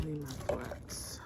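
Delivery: slow whisper to herself. Real, not acted. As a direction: whisper it as a private aside. Muttering to herself while eating.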